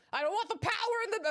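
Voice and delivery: high, fast hammed-up plea; mock pleading lover. Streamer imagining Edgeworth refusing power, mock pleading and frantic. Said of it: "And then he's like".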